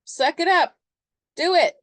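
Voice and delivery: loud, high, blunt order; punchy dismissive command; tough-love retort. Acted. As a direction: shout it like an unsympathetic coach. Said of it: "And they go".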